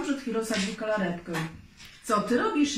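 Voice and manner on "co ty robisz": higher, pained protest; lightly acted wail; moaned question. A narrator's reading. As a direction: groan the question in mild dismay. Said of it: "jęknął".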